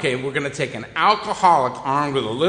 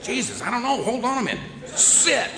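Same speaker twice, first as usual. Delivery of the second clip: flustered owner; 8 semitones higher than usual. Retold or imitated. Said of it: "It's like".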